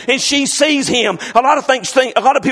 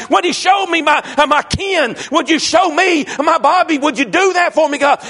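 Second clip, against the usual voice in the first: Job crying out to God; 5 semitones higher than usual. Acted. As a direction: cry out to God like a desperate man. Preacher voicing Job's desperate plea.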